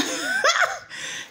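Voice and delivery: high, bursting laugh. Real, not acted. Vlogger laughing at the man turning on the gas, amused laugh. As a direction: break up laughing. Teller laughs at own story.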